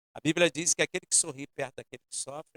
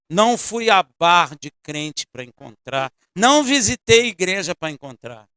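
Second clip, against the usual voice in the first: emphatic preaching; 2 semitones higher, 10 dB louder than usual. Real, not acted.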